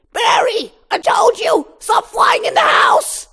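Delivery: high, loud shrill mom scolding; scolding mother. Acted.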